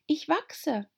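put-on high young girl voice; little girl Alice. A narrator's reading.

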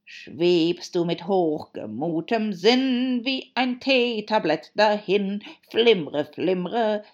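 loud, slow recitation of a song verse; loud, stretched-out declaiming; declaimed verse. A narrator's reading. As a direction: draw the words out grandly. Hatter recites his song verse.

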